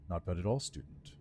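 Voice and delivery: hushed dry lich, last word breathed. Acted. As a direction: undead lord trailing into a whisper. Lich greets the player again.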